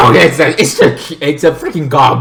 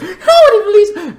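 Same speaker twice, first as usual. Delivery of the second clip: mock high wail; 5 dB louder than usual. Mocking the disbelieved heroine.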